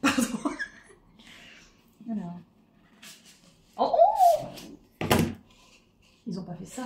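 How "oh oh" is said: oh très aigu de surprise; s'exclamer, émerveillée; surprise ravie. Real, not acted. Découvre le cadeau. (cri de surprise aigu)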